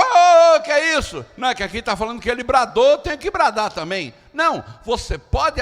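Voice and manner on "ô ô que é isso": high, loud exclamation of alarm; startled protest. Acted. Bus passengers objecting to the sudden yell, startled. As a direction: react startled, pitched way up.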